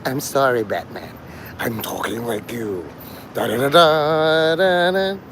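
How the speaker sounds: a croaky, half-voiced Joker; hoarse Joker. Mocking Batman as a hoarse Joker. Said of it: "Imagine Joker with a laryngitis".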